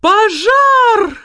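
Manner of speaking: высокий громкий вопль; закричать тонким чужим голосом; крик тонким голосом. A narrator's reading. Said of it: "тонким незнакомым голосом закричала". (тонкий испуганный крик)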